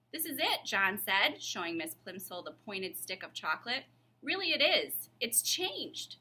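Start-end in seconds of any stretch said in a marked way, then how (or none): 0.1-0.7 higher schoolboy voice, eager; young John. Acted. John shows teacher the chocolate, eager boy voice. John's voice in story. 4.2-6.2 put-on higher boy voice, insisting; young John pleading. John insists to Miss Plimsoll, higher pleading boy. John's voice in story.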